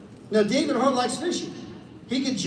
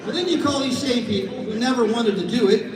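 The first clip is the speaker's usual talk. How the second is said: ordinary delivery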